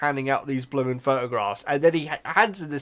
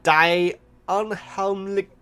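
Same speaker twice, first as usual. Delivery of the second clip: slow, deliberate German accent; attempted German pronunciation, drawn out; 3 semitones higher, much slower than usual. Shown as a demonstration. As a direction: read it out slowly in a German accent. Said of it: "here's my German accent".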